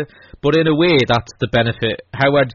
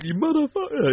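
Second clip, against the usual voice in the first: high imitated wrestler yelling; mock shouting; 7 dB quieter than usual. Wrestler shouting at a rival, high mock yell. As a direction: yell it like a changing-room brawl. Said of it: "He's like".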